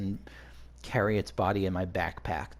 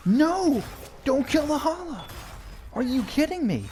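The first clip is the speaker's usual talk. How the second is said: shrieking much higher and louder, voice cracking; real horrified scream; 6 dB louder than usual. Real, not acted. Player screaming at a party member killing the Hala, real horrified shrieks. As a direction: scream at them to stop the kill. Ally kills the sacred Hala.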